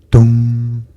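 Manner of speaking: loud, booming "dong" held on one note; clock chime. A narrator's reading. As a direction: imitate a striking clock, ringing out the hum. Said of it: "said the clock".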